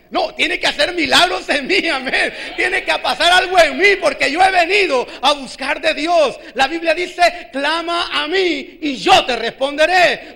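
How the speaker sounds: fired-up preaching. Real, not acted. Preacher urging the church to expect their own miracle, fired-up preaching. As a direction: drive it home to the congregation, loud and insistent.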